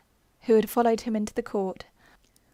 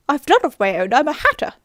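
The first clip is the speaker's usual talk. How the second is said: Hatter; 4 semitones higher, 10 dB louder than usual. Acted. Hatter explaining himself at trial.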